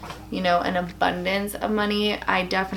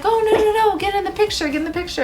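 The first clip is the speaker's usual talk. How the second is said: friendly insistence; 11 semitones higher, 4 dB louder, much faster than usual. Retold or imitated. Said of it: "he was like".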